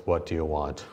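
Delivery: a hint of a wary friend, low and soft; retold wary reply. Blackmailed friend answers the speaker, low wary reply. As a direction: lightly voice a reluctant friend, dropping low.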